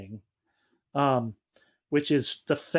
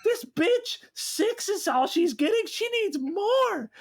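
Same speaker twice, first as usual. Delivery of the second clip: high, slow mock villain voice, laughing through it; mocking Tony; 11 semitones higher than usual. Mocking Tony to the co-host, high and hammy. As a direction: ham up a scornful boss. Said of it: "Tony's like".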